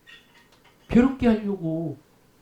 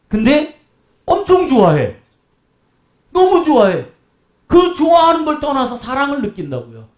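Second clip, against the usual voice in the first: excited; 6 semitones higher, 3 dB louder than usual. Real, not acted. Preacher emphasizing wife's joy.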